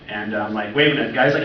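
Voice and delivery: ordinary talk, nothing marked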